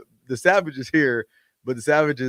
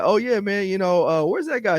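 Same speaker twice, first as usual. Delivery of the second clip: higher-pitched, breezy name-dropper; casual driver quote; 8 semitones higher than usual. Retold or imitated. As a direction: lightly voice a chummy driver. Voicing the driver in a story.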